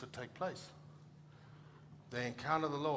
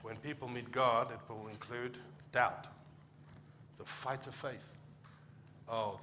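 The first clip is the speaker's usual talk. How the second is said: ordinary talk, nothing marked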